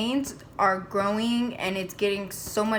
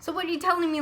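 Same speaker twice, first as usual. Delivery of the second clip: higher-pitched, incredulous question; retold disbelief; 7 semitones higher, much faster than usual. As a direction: challenge the news in disbelief. Retelling her disbelief at doctor.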